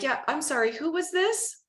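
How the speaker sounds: rising, puzzled who-was-this. Retold or imitated. Agent to a chatty caller who never gave a name, puzzled. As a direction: ask who it is, baffled. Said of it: "I'm like".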